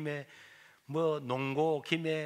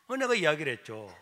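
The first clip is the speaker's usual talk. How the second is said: usual speaking voice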